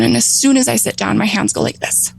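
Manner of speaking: ordinary delivery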